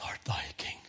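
very soft, breath-only mocking question; whispered sarcasm. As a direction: whisper Pilate's scorn. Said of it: "The sarcasm of Pilate to Christ".

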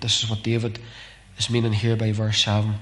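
usual speaking voice

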